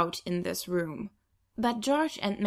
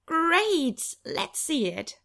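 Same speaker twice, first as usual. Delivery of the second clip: fake enthusiasm; 8 semitones higher, much slower than usual. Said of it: "shroud my grimace with a fake smile".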